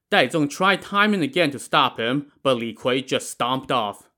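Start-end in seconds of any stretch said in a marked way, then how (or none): none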